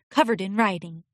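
plain reading voice

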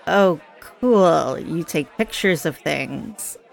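slow, falling mock-uninterested reply; blank, unimpressed. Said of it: "give me this blank stare like".

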